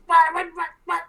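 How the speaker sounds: high loud squeaky panic; panicked kobold. Acted. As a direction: squeak in falling terror.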